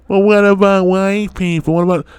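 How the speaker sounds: high mocking voice of a critic; mocking whiny critic. Mocking the critics he doesn't want to hear from, whiny. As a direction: whine it like the people he's dismissing. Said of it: "I don't wanna hear".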